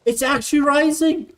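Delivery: amazed the water really rises; surprised. Real, not acted. Gamer reacting to rising water, surprised. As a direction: pitch jumps up in disbelief. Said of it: "Wait, does it actually?".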